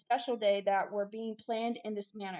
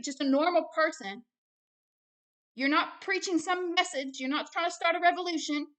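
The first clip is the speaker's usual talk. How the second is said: heated, loud rebuke; worked-up, forceful challenge to the listener; mad; 3 semitones higher, 6 dB louder than usual. Real, not acted. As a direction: press each point hard, loud and raised in pitch. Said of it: "I'm mad".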